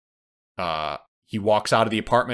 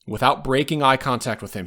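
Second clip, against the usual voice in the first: usual speaking voice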